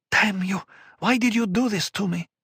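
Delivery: high, sharp furious cry; angry outburst. Acted. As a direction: lash out in anger. Murbella curses.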